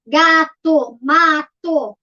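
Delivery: each in two beats, GA, TO, MA, TO; syllable by syllable. Shown as a demonstration. Teacher gives simple two-syllable examples, syllable by syllable. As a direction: split GATO and MATO into separated syllables. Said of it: "Primeiro, palavras de sílabas".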